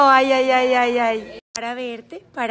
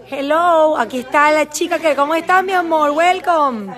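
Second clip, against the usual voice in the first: excited welcome; 5 semitones higher, 10 dB louder than usual. Real, not acted. Greeting new client on camera.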